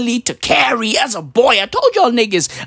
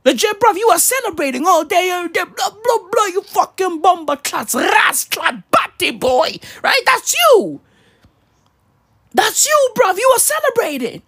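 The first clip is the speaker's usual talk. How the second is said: high, excited fake Jamaican patois; mock patois rant; 9 semitones higher than usual. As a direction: rattle off patois insults high and fast. Mocking a friend in put-on patois.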